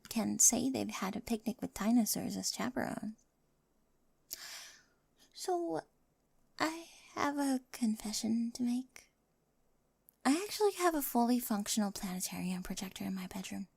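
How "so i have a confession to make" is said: slow, soft whispered confession; nervous whispered secret; shy whisper. Acted. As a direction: confess it bashfully.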